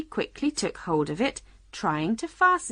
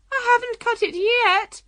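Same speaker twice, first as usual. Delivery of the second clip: high, loud distressed Queen; between screams; 7 dB louder than usual. A narrator's reading. Queen answering Alice between screams, distressed. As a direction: protest in a high wail. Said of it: "in between the Queen's screams".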